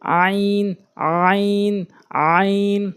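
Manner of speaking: আইন given full weight from the throat; heavy deliberate আইন. Shown as a demonstration. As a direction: lean on each আইন. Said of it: "ভর দিয়ে বলতে হবে".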